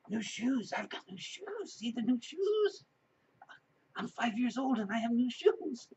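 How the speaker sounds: put-on high little boy boasting voice; excited child. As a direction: show off like a thrilled five-year-old. Boy showing off new shoes.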